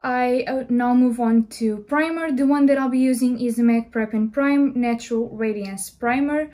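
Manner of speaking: ordinary talk, nothing marked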